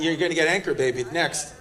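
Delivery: ordinary delivery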